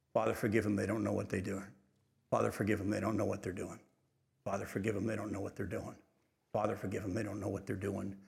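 a quiet plea repeated under the breath; soft repeated prayer. Acted. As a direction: pray it over and over, dying away. Jesus praying repeatedly while nailed.

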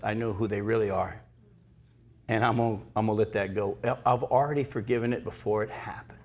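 soft, hushed self-talk; murmured to yourself, near a whisper; quiet inner voice. Retold or imitated. Talking to yourself about a friend, quiet and hushed. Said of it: "have the attitude that says".

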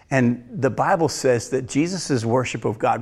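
normal talking voice

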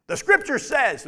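mock Scripture-quoting authority; authoritative mimicry. People using Scripture to back up being in charge, mock authoritative.

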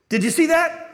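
put-on high, loud astonished cry of a girl; excited girl. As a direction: gasp it out as if you just saw a miracle. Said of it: "she goes".

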